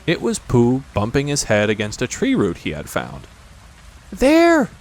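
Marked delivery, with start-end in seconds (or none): 4.1-4.7 high, loud squeak; frightened Piglet. A narrator's reading. Piglet hears the heffalump, high alarmed squeal. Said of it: "said Piglet".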